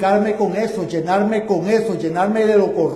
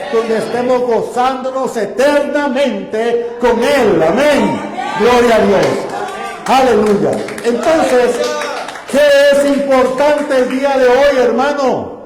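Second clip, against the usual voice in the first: louder, rising preacher's call to praise; full-force preaching; 4 semitones higher, 6 dB louder than usual. Acted. Pastor urging the church to praise the Lord, loud preaching. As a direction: lift the congregation up loud.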